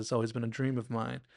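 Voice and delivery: ordinary delivery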